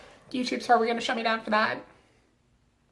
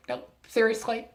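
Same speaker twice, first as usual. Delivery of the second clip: ordinary talk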